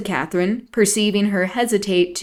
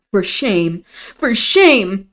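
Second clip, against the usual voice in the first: angry repeat; 6 dB louder, much slower than usual. A narrator's reading. Isabella rebuking Catherine, angry. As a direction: rebuke her angrily, building. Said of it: "she repeated angrily".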